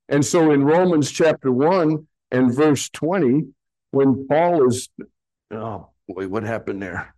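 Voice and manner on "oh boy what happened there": much softer, lower aside to oneself; muttered aside. Real, not acted. Preacher after pressing wrong button, muttered aside. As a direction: drop to a low, puzzled mumble.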